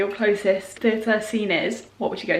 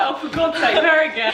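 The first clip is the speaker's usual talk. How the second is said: higher-pitched, laughing imitation of a fed-up viewer; mock exasperation; 5 semitones higher than usual. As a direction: play an annoyed viewer through a laugh. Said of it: "imagine if someone sees every time".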